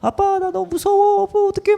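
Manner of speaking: very high, drawn-out scared wail; hammed-up childlike fearful whine; mock frightened child. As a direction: imitate a terrified son crying for dad. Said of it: "그랬더니 막 소리가 들려요".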